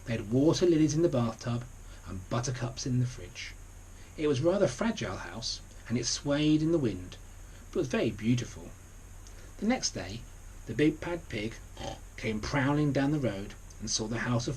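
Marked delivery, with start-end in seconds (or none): none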